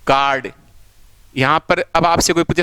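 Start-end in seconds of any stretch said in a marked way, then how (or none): 0.1-0.5 "कार्ड" with a long open aa; long vowel sound. Shown as a demonstration. Teacher explaining the faint r, "कार्ड" with long aa. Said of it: "क्योंकि long sound है".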